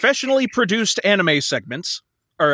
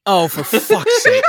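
laughing groan, higher and slow; exasperated laugh; 11 semitones higher, 3 dB louder, slower than usual. Real, not acted. Exasperated at min-max segment.

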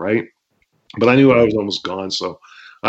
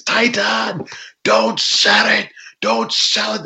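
high-pitched, urgent referee voice; yelling referee; 13 semitones higher, slower than usual. Retold or imitated. Referee to the wrestler taking kicks, imitated yelling. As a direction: mimic a referee urging a wrestler on. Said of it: "he's yelling".

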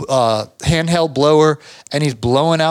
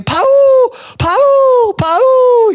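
a much higher, louder scream of Paul; screaming a name; 7 dB louder, much slower than usual. Retold or imitated. Helper stuck on the roof screaming for Paul in a panic, much higher and louder. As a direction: shriek the name like an emergency. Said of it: "screaming my name".

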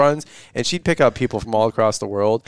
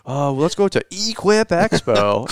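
high, slow drawl with a long E in EQUIP; mock country accent; 4 semitones higher, slower than usual. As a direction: imitate their country accent, stretching Equip. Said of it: "the Almonds, dude, on their podcast".

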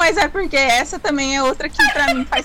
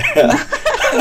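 burst of laughter; 11 semitones higher than usual. Real, not acted. Laughs at the joke.

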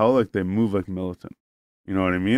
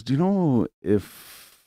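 higher, slow, drawn-out question opening; imitating hesitant girl; 4 semitones higher, much slower than usual. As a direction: stretch it out as a tentative mock query. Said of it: "she goes".

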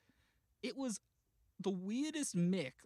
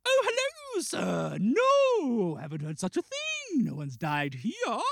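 loud, swooping high theatrical greeting; hammed-up stagey host, starting very high; affected staff voice; 7 semitones higher, 10 dB louder, slower than usual. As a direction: sing out grandly like a period host. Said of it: "they speak like this".

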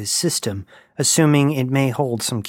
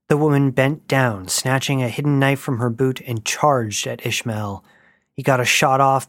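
ordinary delivery

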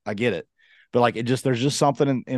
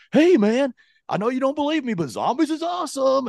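much higher, mock-gushing imitation; hyped-up friend; 14 semitones higher than usual. Teasing a friend about overselling zombies, hyped-up mimicking voice. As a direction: mimic an overexcited friend's pitch. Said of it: "you hyped it up".

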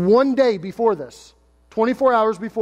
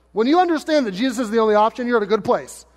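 ordinary talk, nothing marked

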